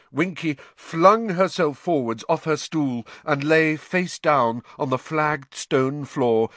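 usual speaking voice

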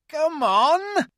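high, loud, joyful beaver cry; a beaver almost dancing with delight; excited beaver. A narrator's reading. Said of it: "cried Mister Beaver".

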